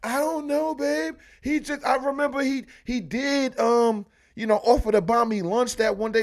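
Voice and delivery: high-pitched, hesitant wife voice; imitated wife. Wife in the bedroom explaining herself to husband, high and hesitant. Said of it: "she in the bedroom like".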